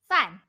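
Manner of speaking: "fan" said with a sharp falling fourth tone; fourth tone demo. Shown as a demonstration. Teacher shows the class the fourth tone, sharp fall. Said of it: "Fourth tone".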